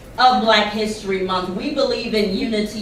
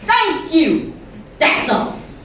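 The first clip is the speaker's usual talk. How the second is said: demo audience hype; 8 semitones higher than usual. Shown as a demonstration. Host modelling praise for performers, hyped-up approval. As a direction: shout appreciation as a pretend audience member. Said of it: "you can say certain things".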